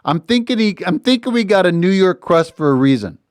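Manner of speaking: New York pizza guy, imitated; New York accent. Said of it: "a little bit of a New York accent".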